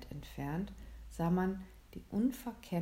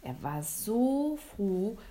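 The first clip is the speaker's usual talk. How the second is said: ordinary delivery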